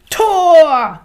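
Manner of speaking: sehr hoher, lauter Jubelschrei; Tor! laut herausjubeln; Torschrei. Acted. Torjubel beim Fußball. (Leo jubelt laut über das Tor)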